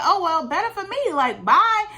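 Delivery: a high, loud, breezy brush-off; dismissive. Retold or imitated. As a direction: wave him off, bye. Brushing him off in the retelling.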